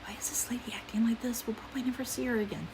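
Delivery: a catty aside under the breath; imitating gym ladies whispering; whispered gossip. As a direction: whisper it to each other. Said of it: "but they're like".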